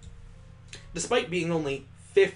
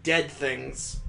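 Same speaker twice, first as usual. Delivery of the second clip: ordinary delivery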